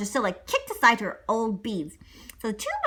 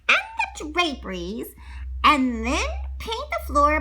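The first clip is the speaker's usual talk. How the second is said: bossy stepmother; 8 semitones higher, 3 dB louder than usual. Acted. Stepmother listing chores.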